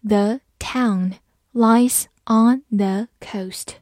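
"The town lies on the coast" slowly, word by word; slow reading. Shown as a demonstration. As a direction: separate each word with a small pause. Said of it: "慢慢来读".

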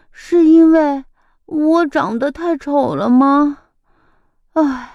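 little slug's sad sighing voice; sighing sadly. A narrator's reading. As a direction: sigh it out, lost and hurt. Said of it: "小鼻涕虫边说边轻轻叹了口气".

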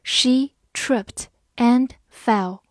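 very slow, one word at a time; slow, separated teacher's model; slow reading. Shown as a demonstration. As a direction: read it slowly, pausing after each word. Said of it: "慢慢来读".

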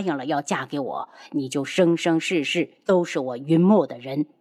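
low, commanding lover; cold possessive boss. Acted. Lover claims bride, low voice.

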